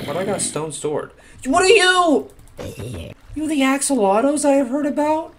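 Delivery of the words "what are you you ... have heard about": high, loud gushing wonder; amazed squeal. Real, not acted. Player meets axolotls heard about, amazed squeal. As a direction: squeal the questions in excited wonder.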